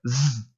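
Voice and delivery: a sustained voiced "z" buzz; demonstrated buzzing "z"; buzzing z sound. Shown as a demonstration. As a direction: hold the "z" like a buzz. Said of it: "É um som de zumbido".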